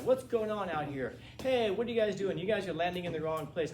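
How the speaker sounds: indignant teacher challenging strangers outside; calling out. Acted. Teacher goes outside to confront landing paratroopers, calling out.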